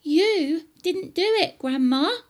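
high, loud, insistent George; indignant high-pitched boy's shout. Acted. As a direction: shout back up at Grandma. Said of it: "George shouted".

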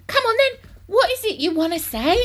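high, loud, exasperated demand; overexcited father cutting in loudly; impatient. A narrator's reading. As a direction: snap at the boy to speak up. Father impatiently cuts off his son.

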